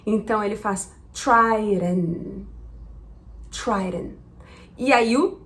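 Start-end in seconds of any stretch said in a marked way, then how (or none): 1.1-4.2 "tryden" with a quick flapped D. Shown as a demonstration. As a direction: tap the D of "tryden" lightly. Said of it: "existe também o flap D".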